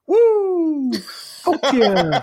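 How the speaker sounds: high, loud wrestler whoop; Ric Flair. Retold or imitated. A man cheers the blast in the city square with a loud, high whoop. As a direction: let out a wild ring-entrance woo. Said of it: "Just Ric Flair it".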